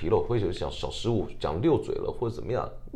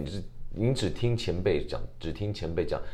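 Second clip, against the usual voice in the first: ordinary delivery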